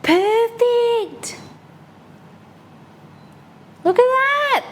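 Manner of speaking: high, delighted squeal. Real, not acted. Delighted at the perfect taiyaki.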